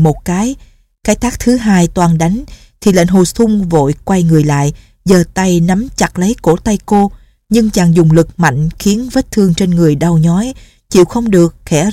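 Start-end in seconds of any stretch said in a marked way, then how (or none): none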